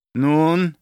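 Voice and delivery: loud, impatient single-word question; impatient prompt. A narrator's reading. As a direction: press for the answer. Baron presses the hesitating captain.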